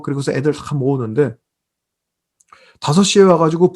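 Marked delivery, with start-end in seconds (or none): none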